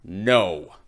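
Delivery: loud flat no; firm refusal. Acted. Fish refuses the fisherman's last wish, loud firm no. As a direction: shut it down hard.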